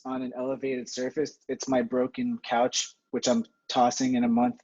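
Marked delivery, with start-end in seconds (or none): none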